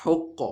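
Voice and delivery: حق said loud and high with a bouncing qaf; teacher modelling a qalqala on حق; recited syllable. Shown as a demonstration. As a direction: pop the qaf at the end of حق. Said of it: "اس کو ہم پڑھیں گے".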